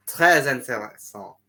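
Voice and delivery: "Très intéressant" with French vowels; French accent. Shown as a demonstration. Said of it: "Oh, in French".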